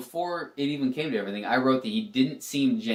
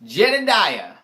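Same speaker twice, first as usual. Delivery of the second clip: louder, higher drawn-out name; playful announcing; 6 semitones higher, 7 dB louder, slower than usual. Acted. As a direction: call it out with a swooping flourish. Playfully drawing out contestant's name.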